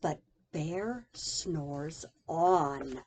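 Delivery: drawn-out low sleepy line; slow sleepy refrain. A narrator's reading. Reading to child, slow sleepy refrain. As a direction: lull it like a snore.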